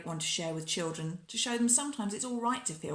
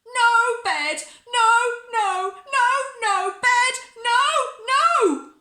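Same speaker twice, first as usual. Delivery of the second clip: high, loud shouted refusals; bedtime tantrum; 12 dB louder, slower than usual. A narrator's reading. Bella rolls about refusing bed, shouting in a tantrum. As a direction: yell every no, voice pitched right up.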